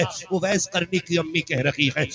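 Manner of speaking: usual speaking voice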